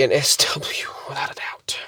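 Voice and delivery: hushed, voiceless whisper. Real, not acted. Naming the creature she witnessed, in a whisper. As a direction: whisper it like a secret.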